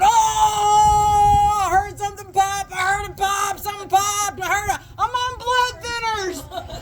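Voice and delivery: hammed-up high wail of pain; mock agonized holler; crying out in pain. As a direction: imitate someone hurt, wailing high and drawn out at the end. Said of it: "crying out in pain".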